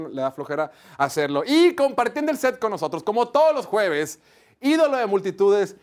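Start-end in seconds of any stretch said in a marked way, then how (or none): 1.4-5.8 loud, high announcer voice; booming host intro. Acted. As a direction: announce the guest like a star.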